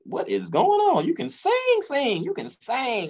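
high-pitched, drawn-out disbelief; amazed. Retold or imitated. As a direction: replay being stunned by a singer, voice way up. Acting out amazement at her singing.

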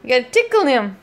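ordinary talk

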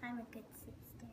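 breathy whispered aside; whispering. Real, not acted. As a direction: whisper it to yourself.

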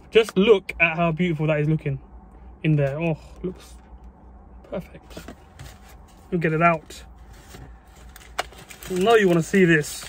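normal talking voice

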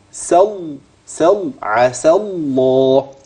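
سل with seen closed straight onto L, then slow عسى الله; doubled lam. Shown as a demonstration. Teacher breaks it down step by step, stressed doubled lam. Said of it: "لام مشددة".

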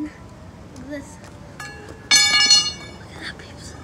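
hushed whisper to viewers. Real, not acted. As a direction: whisper like sharing a secret. Hushed look at pieces.

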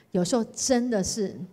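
ordinary talk, nothing marked

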